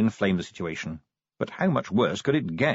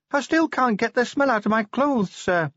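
put-on much higher, timid young dwarf voice; young dwarf Cheery; 14 semitones higher than usual. A narrator's reading. Cheery correcting Vimes about the stamps, timid and high. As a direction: correct the boss gently, pitched up high.

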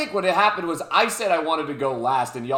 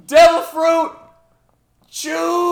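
announcer intro; 10 semitones higher, 9 dB louder than usual. Acted. Opening the show announcement.

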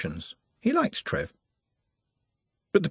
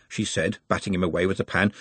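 plain reading voice

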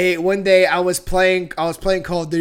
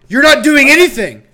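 loud, higher-pitched mock telling-off; exasperated scolding; 7 semitones higher, 8 dB louder, faster than usual. Retelling a telling-off of the dog, exasperated. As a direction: scold in loud disbelief, half laughing.

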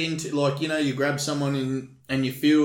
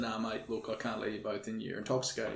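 soft, lower, understated bouncer talk; low-key refusal; 4 semitones lower, 12 dB quieter than usual. Retold or imitated. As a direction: turn them away without raising your voice. Retells bouncer refusing entry calmly.

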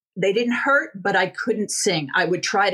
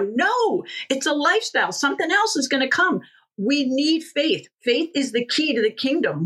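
higher, impassioned insistence; fervent preaching; 6 semitones higher than usual. Real, not acted. Preacher insisting to her audience that faith is lifelong, fervent. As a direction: drive the point home from the pulpit.

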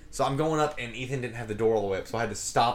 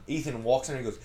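ordinary delivery

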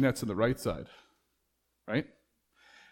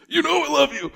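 much higher, louder pleading answer; more intense; 13 semitones higher, 6 dB louder than usual. Retold or imitated. Peter protesting his love to Jesus, high and loud. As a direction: cry it out, exasperated. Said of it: "Peter's response is".

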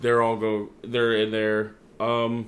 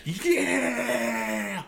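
hyped yeah; 8 semitones higher than usual. Retold or imitated. Usos getting hyped.

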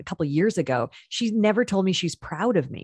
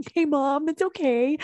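higher, lightly voiced kids reassuring; kids humoring mom; 9 semitones higher than usual. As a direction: pat mom on the head verbally. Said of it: "they're always like".

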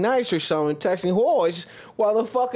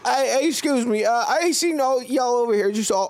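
much higher dopey mock voice; goofy put-on voice; 7 semitones higher than usual. Buddy answering cohost with a much higher dopey voice. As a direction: squeal it like a dopey guy.

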